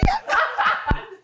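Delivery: sudden high squeal of laughter. Real, not acted. Recalling how she melted for him, high squealing laugh. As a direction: squeal with delight. Memory of falling in love.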